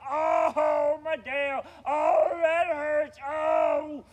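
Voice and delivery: high, very loud wailing; hammed-up howls of agony; screaming in pain. Bear has just lost his tail, screaming in pain. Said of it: "He was yelling and screaming".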